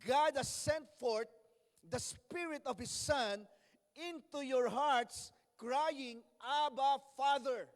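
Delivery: slow, loud pulpit reading; emphatic preacher declaiming scripture; scripture proclamation. Real, not acted. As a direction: ring out each phrase of the verse. Proclaims the verse to congregation.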